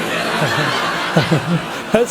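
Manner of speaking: a burst of low laughter; laughing. Real, not acted. Comedian laughing at his own joke, low laughter. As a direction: laugh at your own punchline.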